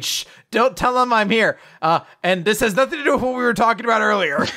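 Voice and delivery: high, loud mock panic; acted frantic urging. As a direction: urgently shush a friend, hammed-up. Acted frantic secret text message.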